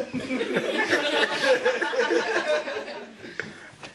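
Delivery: airy laughter rising; breathy high laugh. Real, not acted. Comic laughing at Carlos at the funeral.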